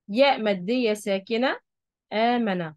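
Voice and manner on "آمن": ordinary talk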